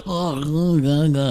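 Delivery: snotty nasal whine. Retold or imitated. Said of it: "talking through my nose and shit".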